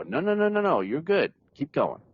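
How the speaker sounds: high, rapid-fire no's easing into reassurance; insistent encouragement. Retold or imitated. Supporter talking a writer out of self-doubt, insistently encouraging.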